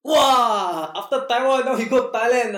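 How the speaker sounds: high, loud excited exclaim; excited teasing. Real, not acted. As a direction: tease him with big excitement. Friend teasing about travel.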